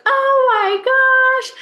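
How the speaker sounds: high, drawn-out squeal of a shout; retold loud shout. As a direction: re-enact a delighted shout, stretched out high. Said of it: "uh, loud shout".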